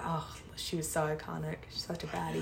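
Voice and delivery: whispered aside. Real, not acted. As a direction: whisper it admiringly. Gushing about a cartoon character.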